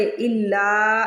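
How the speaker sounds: इल्ला with the ला held long and slow; stretched vowel. Shown as a demonstration. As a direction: draw out the ला in इल्ला. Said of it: "लाम को stretch करेंगे". (इल्ला में ला को लंबा खींचकर)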